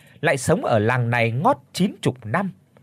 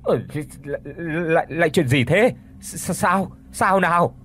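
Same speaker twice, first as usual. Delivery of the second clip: halting, stuttering lines in a frightened voice; stammering in fright; 3 semitones higher than usual. A narrator's reading. Grandfather to his shrieking wife, stammering in fright. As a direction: trip over every word as fear takes hold. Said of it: "mồm thì lắp bắp".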